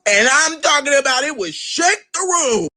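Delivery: high, stretched emphasis; exaggerated emphasis. Real, not acted. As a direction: lean hard into the hyperbole. Emphasising silent drive home.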